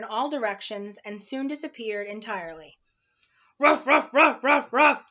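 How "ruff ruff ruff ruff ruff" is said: loud, higher spoken ruffs; dog barking. Acted. Watchdog dashing up to the car, barking loudly. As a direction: bark like an excited big dog. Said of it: "exclaimed the watchdog".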